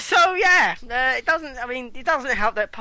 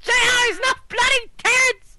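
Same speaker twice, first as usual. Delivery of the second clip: shouting; 11 semitones higher than usual. Real, not acted. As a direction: shout it out in disbelief.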